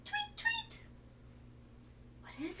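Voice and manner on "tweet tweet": high chirpy bird call; birdy tweet. Shown as a demonstration. Teacher chirping a bird call for kids.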